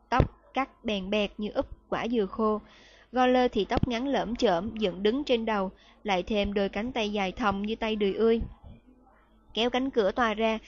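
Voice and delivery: plain reading voice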